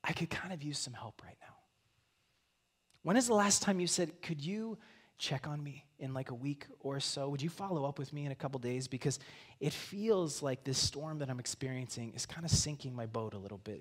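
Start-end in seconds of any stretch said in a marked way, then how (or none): 0.0-1.5 hushed, hesitant plea for help; whisper. Acted. Struggling person reaching out to somebody else, whispered. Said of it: "or just whispered". 4.2-13.8 quiet plea. Acted. Voicing someone asking for help.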